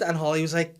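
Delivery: normal talking voice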